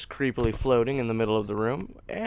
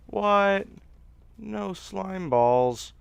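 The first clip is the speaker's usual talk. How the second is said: surprised disbelief; 7 semitones higher, much slower than usual. Real, not acted. Slime dropped nothing.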